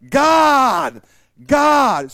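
loud proclaiming shout; shouted God. Real, not acted. Preacher shouting to his congregation. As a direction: call the name out to the room.

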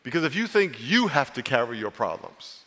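usual speaking voice

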